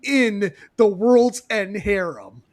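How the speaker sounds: cracking up while quoting; half laughing out the punchline. Retold or imitated. Laughing through Deadpool quote.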